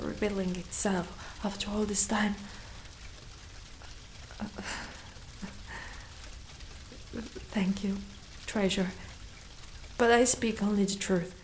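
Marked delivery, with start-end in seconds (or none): 4.2-7.5 slow, heavy sigh of regret; long weary sigh. Acted. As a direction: let out the tiredness in one breath. Weary dragon reflects on failure.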